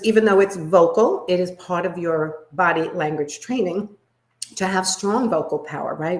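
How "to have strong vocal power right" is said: usual speaking voice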